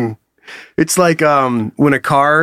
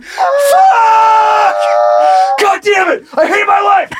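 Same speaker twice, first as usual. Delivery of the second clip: terrified yelling; slower than usual. Pretend. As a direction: scream it out as you plunge through the sky. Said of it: "super high up in the sky".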